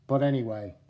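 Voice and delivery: normal talking voice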